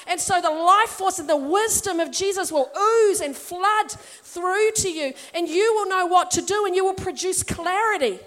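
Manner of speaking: preacher flooding the church with assurance; fervent preaching. Acted. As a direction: pour it out over the congregation.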